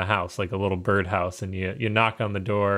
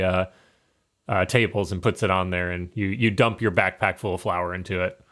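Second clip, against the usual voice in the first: usual speaking voice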